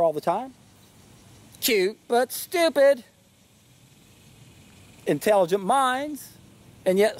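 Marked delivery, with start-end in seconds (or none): 1.6-3.0 high, slow parrot mimicry. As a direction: squawk it out like the bird. Quoting the parrot line.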